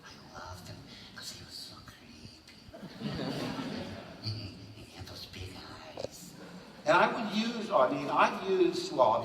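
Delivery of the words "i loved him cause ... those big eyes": much softer, hushed and eerie; a softer, spooky hush; creepy whisper. Real, not acted. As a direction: whisper it like a secret. Whispered Peter Lorre creepiness.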